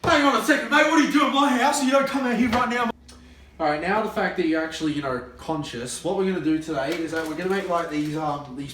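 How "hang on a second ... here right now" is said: loud, high yell at an intruder; angry shout. Acted. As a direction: bellow it out, furious and high. Yelling at intruder in house.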